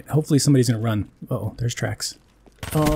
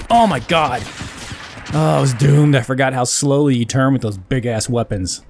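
ordinary delivery

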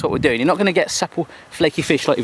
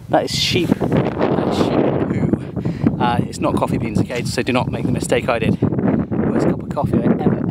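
ordinary delivery

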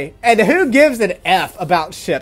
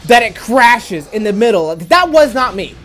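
loud, high, emphatic accusation; real furious complaint, raised voice; angry rant; 4 semitones higher, 5 dB louder than usual. Real, not acted. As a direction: hammer the blame out loudly. Ranting at developer over a crash.

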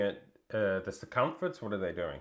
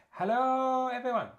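high, bright sing-song hello; sing-song greeting; 13 semitones higher than usual. Pretend. Streamer greeting the chat in a high sing-song. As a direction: chirp it to the whole chat.

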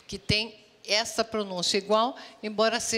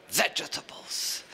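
whispered model; 9 semitones lower, 5 dB quieter than usual. Shown as a demonstration. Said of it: "Say vegetables".

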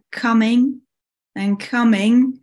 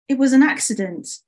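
higher-pitched apologetic excuse; 4 semitones higher than usual. Acted. Winter apologizes to Summer, apologetic. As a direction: plead that it was accidental. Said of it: "Winter apologized".

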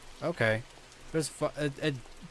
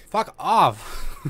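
loud, higher startled curse; startled outburst; 5 semitones higher, 7 dB louder than usual. Real, not acted. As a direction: lash out after a scare, half laughing. Curses after another jump scare.

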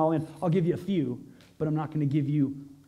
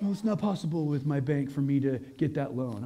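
lower, softer imitation of excuse-making; mock doubter; 5 semitones lower, 5 dB quieter than usual. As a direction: give up before asking, half in parody.

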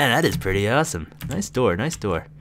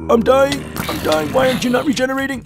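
high-pitched, frantic game panic; panicked; 5 dB louder than usual. Real, not acted. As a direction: cry out in alarm as health runs out. Health running out in game.

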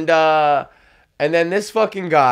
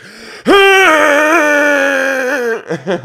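big bursting laugh, much higher and louder; 12 semitones higher, 7 dB louder than usual. Real, not acted. Laughing hard at his own joke.

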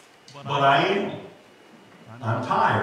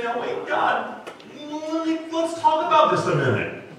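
flustered protest; 9 semitones higher, faster than usual. Retold or imitated. Imagined protest to God, laughing.

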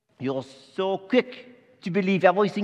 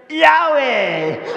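much higher, louder Yahweh; punchline delivery; 9 semitones higher, 7 dB louder than usual. Acted. Angel retelling his pun, higher and louder. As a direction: sell the pun big. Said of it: "and I said".

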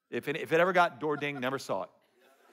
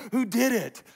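eager who did it; 8 semitones higher, 5 dB louder than usual. Pretend. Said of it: "You're like".

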